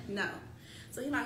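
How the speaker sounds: usual speaking voice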